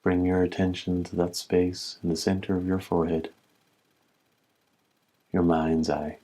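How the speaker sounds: calm, even meditation voice with long pauses; slightly softened, level-toned meditation guide; guided meditation. Acted. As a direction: guide them gently inward, leaving space between phrases. Leads a humming breath meditation.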